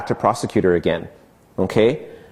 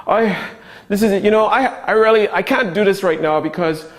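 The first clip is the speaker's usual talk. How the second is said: overwhelmed excuse-maker, pitched up; whiny victim; 7 semitones higher than usual. Shown as a demonstration. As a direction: play the helpless victim begging off. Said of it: "The victim is the".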